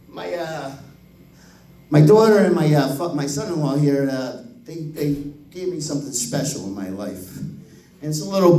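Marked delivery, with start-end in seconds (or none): none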